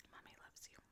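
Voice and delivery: barely whispered tenderness; mother whispering love to her son. Real, not acted. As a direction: breathe it softly.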